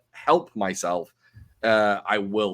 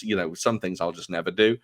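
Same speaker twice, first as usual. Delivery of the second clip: usual speaking voice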